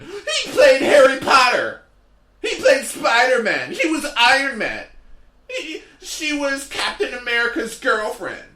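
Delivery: high, loud, laughing fan outburst; excited fan shout. Retold or imitated. Frantic fan yelling the actor's roles at the comic, high and loud.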